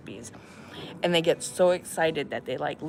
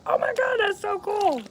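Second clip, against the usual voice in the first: excited. Retold or imitated. Retelling excited reaction to sister.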